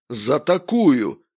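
loud, drawn-out retort; angry outburst. A narrator's reading. Stepan accuses his friend of a setup, loud angry retort. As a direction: throw it back loudly, slow and agitated. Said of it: "Степан нервно потряс пакетом".

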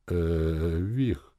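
hesitant, thoughtful hum; pensive drone. A narrator's reading. Gronk hesitantly addressing his friend, pensive. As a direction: draw it out while thinking. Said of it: "задумчиво прогудел Гронк".

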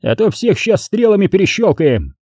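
high, menacing battle call; roared threat. A narrator's reading. Commander threatening cornered Poles with arrows, roaring. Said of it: "взревел Бобёр".

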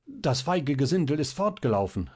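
calling out. A narrator's reading. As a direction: call it out, quickly recovered. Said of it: "rief dieser".

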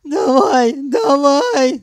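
loud, high crying howl. A narrator's reading. Sanyok goading the man kicking him, howling. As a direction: howl it out, daring him through tears. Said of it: "завыл Санёк".

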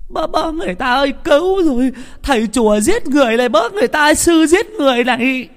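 very high, loud cries for help; squawking screams. A narrator's reading. Old woman cries murder as monk chokes her, shrieking. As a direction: scream like a cackling hen laying an egg. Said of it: "la lên như là con gà mắc đẻ".

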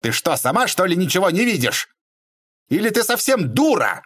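high, exasperated outburst; shouted insult. A narrator's reading. Man calling the lady a fool, high angry shout. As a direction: let the pitch climb into an angry cry. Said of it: "закричал Бобров".

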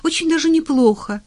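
high, loud, upbeat reassurance; brisk cheer. A narrator's reading. Doctor reassuring a scared old patient, loud and cheerful. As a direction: pitch it up and sound confidently cheerful. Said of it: "бодро сказала она".

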